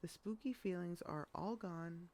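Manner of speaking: ordinary delivery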